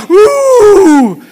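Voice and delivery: high imitated "uuh" howl; mock ghostly howl. Preacher imitates a ghostly wind for the congregation, mock howl.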